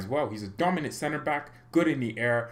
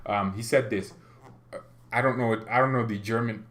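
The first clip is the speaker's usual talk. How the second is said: usual speaking voice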